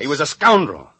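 agitated. Acted. Husband agitated about dream man.

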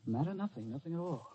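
guarded, soft answer into the phone; quiet, evasive murmur; hushed. Acted. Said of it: "Darling, speak louder".

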